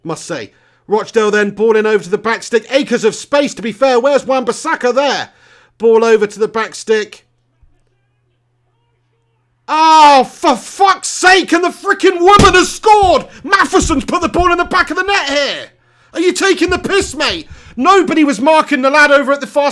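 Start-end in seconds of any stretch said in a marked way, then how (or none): 0.9-7.3 tense commentary. Real, not acted. Live commentary as danger builds. 9.7-15.7 furious high-pitched yell; exasperated shout. Real, not acted. Fan commentator reacting to a conceded goal, exasperated shouting.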